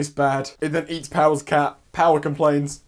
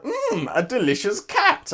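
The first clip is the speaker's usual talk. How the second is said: greedy relish; 7 semitones higher, slower than usual. Retold or imitated. Power eyeing cat as food.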